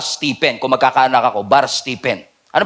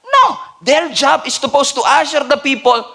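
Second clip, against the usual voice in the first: preaching at full force; 9 semitones higher than usual. Acted. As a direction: drive the point home, climbing. Preaching stays high and loud.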